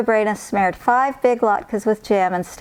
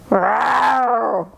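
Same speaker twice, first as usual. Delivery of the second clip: bear roar; 2 semitones higher than usual. Acted. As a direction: roar it out like a big old bear. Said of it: "Old Bear roared".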